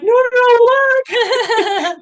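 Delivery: very high and loud, laughing in shock; stunned disbelief. Retold or imitated. Correcting her husband, high, loud and laughing in shock. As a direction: burst out, half laughing, can't believe it.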